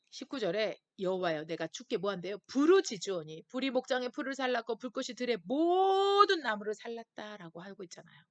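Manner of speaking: normal talking voice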